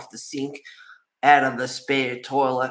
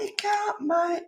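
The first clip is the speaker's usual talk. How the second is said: high, light voice answering the cat; high pitched gentle refusal to the cat; high pitched sympathetic reply; slower than usual. Retold or imitated. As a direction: gently refuse a pleading pet. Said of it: "it's like".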